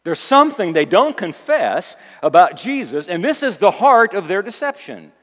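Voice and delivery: loud, insistent sermon with punched key words; emphatic preacher in full flow; forceful preaching. Acted. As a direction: hammer each point from the pulpit.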